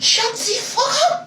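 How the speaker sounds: put-on high, laughing French-accented retort. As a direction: throw it back in a French accent. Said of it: "I had that accent down".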